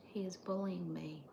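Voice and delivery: conspiratorial whisper to the viewers; hushed mock-tattling. Real, not acted. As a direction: lean in and whisper a playful complaint.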